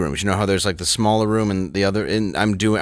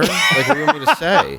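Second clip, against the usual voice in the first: laughing; 11 semitones higher than usual. Retold or imitated. Retelling exchange, laughing heard.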